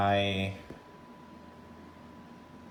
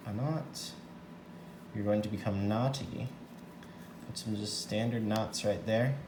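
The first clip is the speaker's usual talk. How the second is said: normal talking voice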